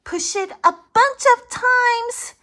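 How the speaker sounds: high, crying-out cartoon voice; high panicked creature voice; alarmed Larry. Larry alarmed, two of him.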